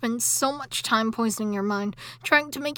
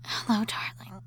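tender whisper; 4 semitones lower than usual. Acted. Wife whispering hello to her groggy husband. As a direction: greet him lovingly in a whisper.

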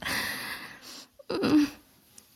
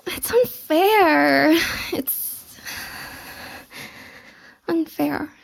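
whiny; 2 semitones higher, much slower than usual. Acted. Whiny complaint.

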